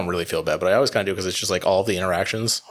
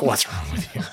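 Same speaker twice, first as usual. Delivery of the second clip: laughing; 2 semitones higher than usual. Real, not acted. Laughing at the cop's reaction.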